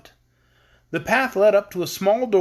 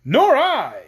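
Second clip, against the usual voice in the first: high character voice; 8 semitones higher, 6 dB louder than usual. Acted. Chorus of characters answering.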